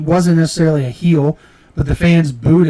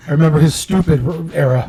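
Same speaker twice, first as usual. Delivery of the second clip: ordinary talk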